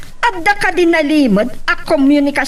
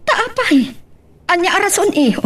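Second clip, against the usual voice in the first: high surprised why; 6 semitones higher than usual. Acted. Mother refused grandchild.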